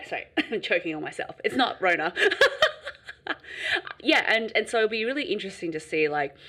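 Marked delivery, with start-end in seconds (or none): 2.2-4.0 airy laugh. Real, not acted. Laughing after cough.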